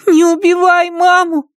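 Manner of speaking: put-on very high, pleading child voice; squeaky, high-pitched frightened child; tearful little boy. A narrator's reading. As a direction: plead like a sobbing small boy. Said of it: "Мальчик всхлипнул".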